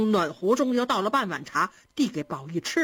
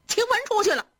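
high-pitched call from indoors; raised, much higher alerting voice; 12 semitones higher, faster than usual. A narrator's reading. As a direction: call out a warning to someone outside. Baoyu calls warning from indoors.

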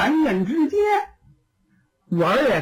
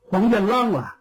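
plain reading voice

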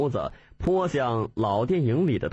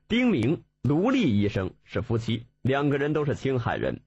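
ordinary delivery, nothing marked

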